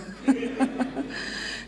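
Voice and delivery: breathy unvoiced chuckle; a quick airy laugh at her own joke; short silent laugh under the breath; soft laugh. Real, not acted.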